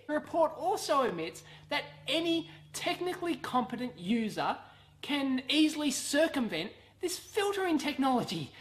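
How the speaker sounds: ordinary talk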